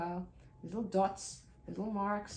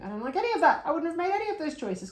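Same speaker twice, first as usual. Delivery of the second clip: skeptical viewer; 9 semitones higher, 10 dB louder, faster than usual. Retold or imitated. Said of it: "you might be looking at this going".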